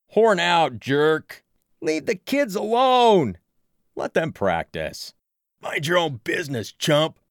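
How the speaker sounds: loud, high heckling yells; jeering shouts. Acted. Crowd heckling the pushy man away, loud. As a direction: jeer him off the field.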